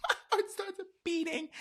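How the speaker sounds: high, squeaky laugh through the words; real laughing. Real, not acted. Storyteller cracking up at her own story, laughing. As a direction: repeat it while cracking up.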